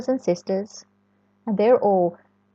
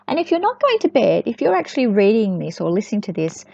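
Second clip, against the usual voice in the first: usual speaking voice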